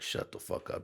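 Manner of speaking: hissed whisper; whispered retort. Retold or imitated. Brushing off the boss's reply, in a whisper. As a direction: cut him off in a whisper. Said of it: "And I was like".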